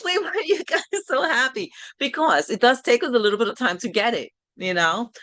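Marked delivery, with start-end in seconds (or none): 0.0-1.7 much higher, laughing through the question; bemused mom, laughing; laughing puzzlement. Retold or imitated. Mom asking kids why they're happy, laughing high. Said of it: "But I'm like".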